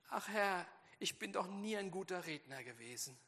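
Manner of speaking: a lighter touch of a timid, self-doubting plea; timid Moses. Acted. Moses pleading with God, mildly self-doubting. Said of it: "wie minderwertig der sich der Mose macht".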